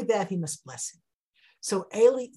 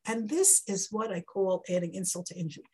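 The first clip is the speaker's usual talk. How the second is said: ordinary talk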